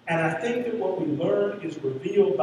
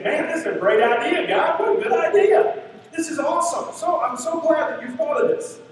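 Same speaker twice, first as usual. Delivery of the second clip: high, loud, gushing over God's great idea; excited Moses; 8 semitones higher, 3 dB louder than usual. Retold or imitated. Moses thrilled by God's plan, loud and high. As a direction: Moses cheering like a fan.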